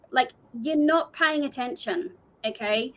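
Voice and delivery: normal talking voice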